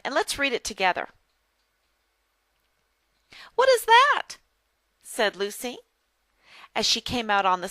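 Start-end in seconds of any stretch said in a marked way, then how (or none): 3.6-4.4 high, loud, surprised question; curious exclamation. Acted. Lucy asks John as she comes out, high and curious. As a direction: ask it high and eagerly, as Lucy.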